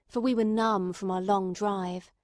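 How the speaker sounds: ordinary delivery, nothing marked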